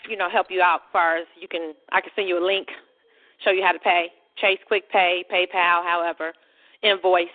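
usual speaking voice

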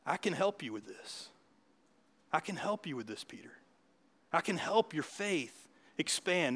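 soft, unhurried offer of help; gentle reassurance. Acted. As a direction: reassure a sinking friend softly. Gentle Jesus reassuring Peter.

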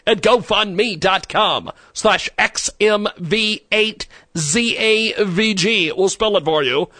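high, loud, slow spelling; slow spelled-out URL. Acted. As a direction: spell each letter out clearly. Spelling out donation link.

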